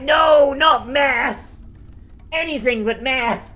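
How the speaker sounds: loud, high hammed-up pleading; mock horror. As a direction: wail in comic dread. Acted pleading against being made to do math.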